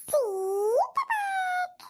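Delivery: high drawn-out whiny cry; childish wail. Acted. Grandson crying to granny for sweets, long high wail. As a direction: wail it like a bawling toddler.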